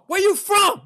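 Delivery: put-on high, loud accented cabbie shout; imitated high-pitched yelling. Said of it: "he was yelling at me".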